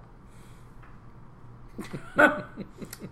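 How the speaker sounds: long, high, breathy fit of laughing; helpless laughter. Real, not acted. Co-host appalled by the joke, helpless laughter. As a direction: lose it completely, mostly on breath.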